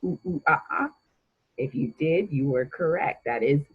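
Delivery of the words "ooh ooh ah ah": higher playful monkey hoots; quick monkey call; monkey sound. Shown as a demonstration. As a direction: hoot like a monkey to show the answer. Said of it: "make a monkey noise".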